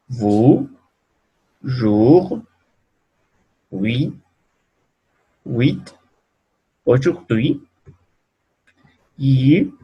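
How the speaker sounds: clear, careful French model words; French vowel demo words. Shown as a demonstration. Teacher modelling French vowels for students, clear and careful. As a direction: enunciate each French word for students to copy. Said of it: "French vowel pronunciation drill".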